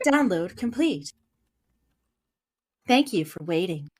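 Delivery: flat Siri-like assistant voice; robot voice. Acted. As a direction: speak like Alexa. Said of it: "I really like your robot voice".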